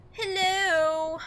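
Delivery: high, loud drawn-out hello; calling out. Real, not acted. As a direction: call out to see who's there. Calling out to someone in game.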